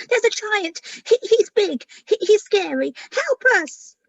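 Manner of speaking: high, loud panicked dragons; frightened plea. Acted. Crowd of scared dragons begging the little earth dragon for help, high and panicky. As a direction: beg for help in a rush of fear.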